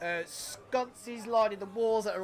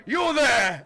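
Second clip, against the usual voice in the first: barkeep call; 3 semitones higher, 7 dB louder than usual. Acted. Barkeep points at Mordai.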